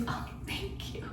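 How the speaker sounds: barely voiced thanks; stunned whisper. Fan stunned by a compliment, whispering thanks. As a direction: breathe it out, taken by surprise. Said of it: "So I was like".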